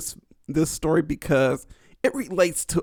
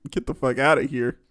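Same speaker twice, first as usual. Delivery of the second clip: ordinary delivery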